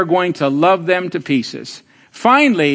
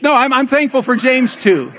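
ordinary delivery

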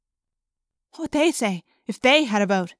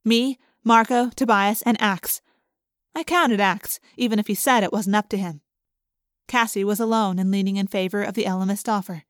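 usual speaking voice

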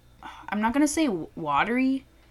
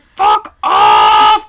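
a far louder, much lower yelled curse; screaming at flies; 16 dB louder than usual. Retold or imitated. Retelling yelling at kitchen flies, a loud guttural shout. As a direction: bellow it at the buzzing flies. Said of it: "I was screaming at the flies".

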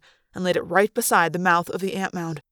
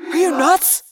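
high, rising disbelieving question; incredulous; 9 semitones higher, 4 dB louder, slower than usual. A narrator's reading. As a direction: question a friend's sanity. Tobias incredulous at frantic order.

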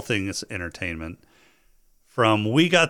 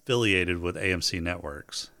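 usual speaking voice

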